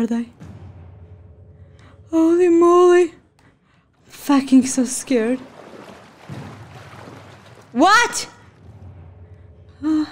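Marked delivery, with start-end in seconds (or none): none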